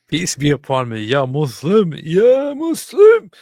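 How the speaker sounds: rising from loud reading to a high, drawn-out holler; hammed-up call that climbs and stretches out; mock zealous cry, building. Streamer calling out to Muslims in jest, mock zealous cry.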